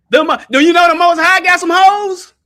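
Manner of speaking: high, loud punchline; excited. Real, not acted. Preacher hyping his joke to the chat, high and loud. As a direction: hype it up.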